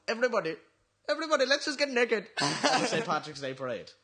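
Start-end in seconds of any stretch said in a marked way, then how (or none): 0.1-2.3 high-pitched cartoon call to a crowd; Apu. Quoting a line at the Saint Patrick's Day parade, high imitation. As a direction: imitate a rallying shout, pitched up. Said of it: "Or Apu's line of".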